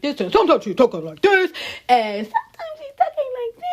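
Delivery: jumps high and loud, swapping voices mid-line. Shown as a demonstration. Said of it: "that [name] transforming of the voice".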